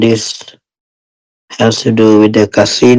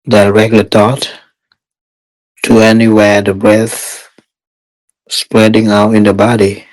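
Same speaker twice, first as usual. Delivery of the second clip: guided meditation. Real, not acted. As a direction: guide meditators step by step, unhurried and even.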